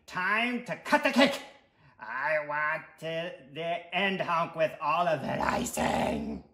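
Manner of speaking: loud, pushy character voice; demanding, stretching out the final craving; impatient sweet tooth. Acted. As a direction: bellow like a greedy, impatient little monster. Story figure: impatient tooth.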